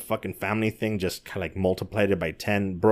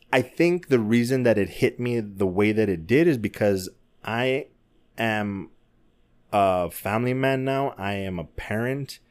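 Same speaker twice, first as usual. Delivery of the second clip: ordinary talk, nothing marked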